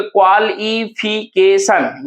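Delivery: क्वालिफिकेशन slowly in five parts; क्वालिफिकेशन with every syllable separate. Shown as a demonstration. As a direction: space out kwal, i, fi, ke, shan in क्वालिफिकेशन. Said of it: "यानी कि एक".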